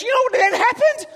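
loud, very high breathless-with-news question; excited child. Retold or imitated. Son updating dad on the story, loud and high. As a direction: play a kid who can't wait to tell.

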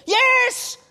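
put-on high, loud kid's yes; excited son. Son assures doubting Dad, high excited shout. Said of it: "he goes".

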